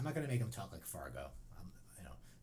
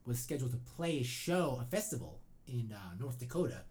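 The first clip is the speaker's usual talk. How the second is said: usual speaking voice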